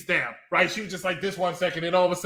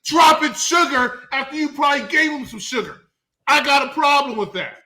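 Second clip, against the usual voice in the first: raised, louder mock rant; mock shouting rant; 6 semitones higher, 5 dB louder than usual. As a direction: yell at her like a fed-up husband. Ranting at the wife.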